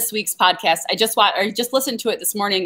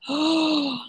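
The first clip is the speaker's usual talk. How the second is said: long audible gasp, softer than speech; surprised gasp; 5 semitones higher, 5 dB quieter than usual. Real, not acted. Gardener hears the seed shop is not open, surprised gasp. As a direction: gasp at disappointing news.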